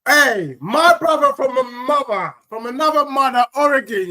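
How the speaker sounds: host hyping up the next caller; hype greeting. Real, not acted. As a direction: greet him like a stream host.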